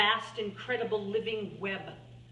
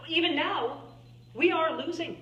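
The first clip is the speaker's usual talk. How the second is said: forceful declaration; 7 semitones higher, 4 dB louder than usual. Acted. Urgent climax of public testimony.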